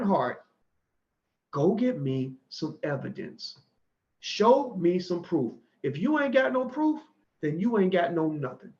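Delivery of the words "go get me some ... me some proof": slow, every word spaced and weighted; slow deliberate demand. Real, not acted. Daring doubters to bring proof, slow and deliberate. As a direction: lay down each word like a verdict.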